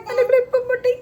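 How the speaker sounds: high, loud tearful wail over the clip; crying wail. Real, not acted. Child crying to mother that her clip broke, wailing.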